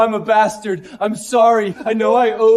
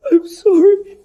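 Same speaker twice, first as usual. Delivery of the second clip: high, louder sobbing cry; sobbing wail; 11 semitones higher, 4 dB louder than usual. Acted. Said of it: "Alexander broke down".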